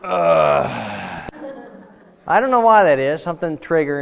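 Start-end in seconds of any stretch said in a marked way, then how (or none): 0.0-1.3 low grunt standing up; laughing groan. Retold or imitated. Preacher imitating old people rising, low laughing groan. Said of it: "whenever they get up".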